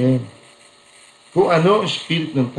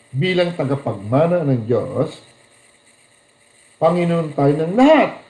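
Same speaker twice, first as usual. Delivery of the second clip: ordinary delivery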